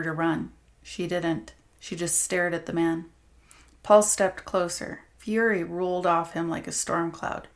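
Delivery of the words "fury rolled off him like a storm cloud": ordinary delivery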